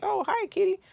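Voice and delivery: put-on high, sweet baby-talk greeting to a cat; neighbor's cooing voice. Neighbor greeting the cat at the door, high cooing voice. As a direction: coo it up high like talking to a kitty. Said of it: "And he's like".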